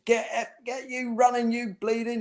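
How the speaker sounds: high mock yell from the bushes; half-laughing imitation of a screaming manager; retold scream. Manager yelling at lagging players, mock scream. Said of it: "he's screaming from the bushes".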